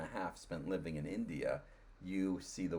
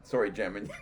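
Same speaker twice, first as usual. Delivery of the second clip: normal talking voice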